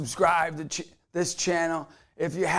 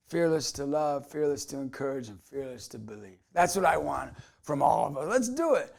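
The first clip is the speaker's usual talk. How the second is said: normal talking voice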